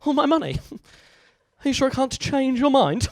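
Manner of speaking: high, put-on pleading voice; meek mugging victim. Victim trying to dissuade a mugger, mock high meek voice.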